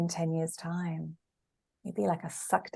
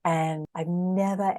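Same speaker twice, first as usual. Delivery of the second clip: ordinary talk, nothing marked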